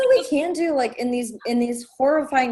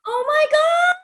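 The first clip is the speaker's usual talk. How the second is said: high thrilled "Oh my God"; real excited squeal; 3 dB louder than usual. Real, not acted. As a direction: squeal the news out with delight. Friend hears big news.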